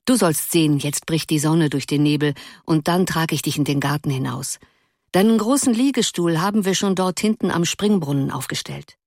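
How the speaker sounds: usual speaking voice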